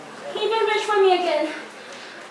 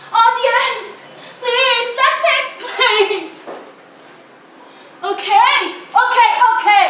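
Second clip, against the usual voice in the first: loud, higher, slowed plea; urgent appeal; 4 semitones higher, 6 dB louder, slower than usual. Acted. Girl pleading with the audience, loud and urgent. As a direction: beg the audience to listen.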